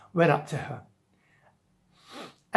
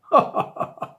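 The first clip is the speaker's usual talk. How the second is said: short voiceless policeman's chuckle; mock ha-ha-ha from the policeman; scoffing laugh. Policeman scoffing at Jack's question, laughing.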